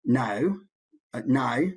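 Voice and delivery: Australian "no" with a bent, gliding vowel; Australian diphthong on "no"; Australian accent. Shown as a demonstration. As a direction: say "no" the Australian way, vowel sliding like "nigh". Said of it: "Australian has this funny".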